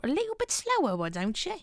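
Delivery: high squeak, slowing down; squeaky high voice. Pretend.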